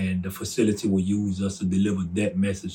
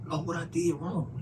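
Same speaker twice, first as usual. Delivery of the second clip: confused plea; 7 semitones higher, 6 dB quieter than usual. Retold or imitated. Confused prayer to God.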